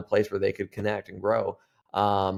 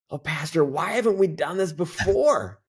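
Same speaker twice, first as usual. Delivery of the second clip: put-on high excited old lady; 7 semitones higher than usual. Elderly lady suddenly won over.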